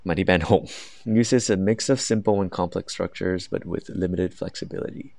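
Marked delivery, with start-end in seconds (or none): 1.2-5.1 slow, careful rubric reading; very slow, deliberate reading of criteria; reading aloud. Shown as a demonstration. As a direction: read the descriptor out word by word. Reads band descriptor aloud.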